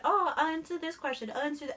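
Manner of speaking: high, bright mock-professional; organized YouTuber voice. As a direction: play the perfect YouTuber.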